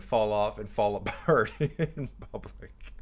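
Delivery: breaking into a laugh mid-phrase; real laugh taking over the words. Real, not acted. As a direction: let the line dissolve into laughter. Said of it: "I'm laughing".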